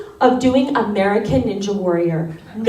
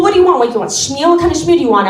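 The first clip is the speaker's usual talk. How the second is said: gruff New Yorker; 3 semitones higher, much faster than usual. Retold or imitated. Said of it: "New York bagel clerk".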